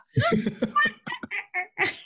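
high, cackling witch laugh; evil witch laugh. Pretend. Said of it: "That's my evil witch laugh".

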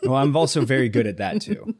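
laughing through the words. Real, not acted. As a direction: speak quickly while laughing. Laughing at own wordiness.

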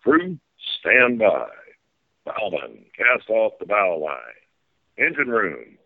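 folksy tour-guide captain calling the sights; riverboat captain spiel. Pretend. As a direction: play the steamboat captain giving the tour.

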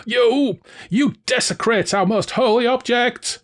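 high, outraged villain voice; Valjean, unbelieving. A narrator's reading. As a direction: sputter in disbelief, pitched high. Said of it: "Valjean said unbelievingly".

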